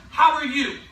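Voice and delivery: bright, loud opening with a laugh; upbeat cold-call hello; enthusiastic greeting. Shown as a demonstration. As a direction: greet like you're thrilled to call. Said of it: "Enthusiasm is absolutely key".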